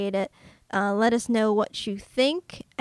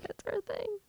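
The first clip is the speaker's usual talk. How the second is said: high squeaky voice; 12 semitones higher, 12 dB quieter than usual. Pretend. Echoes partner in put-on squeak.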